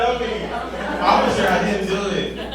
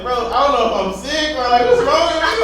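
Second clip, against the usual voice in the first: mock worried panic; 6 semitones higher, 5 dB louder than usual. Panicking on edible in bed.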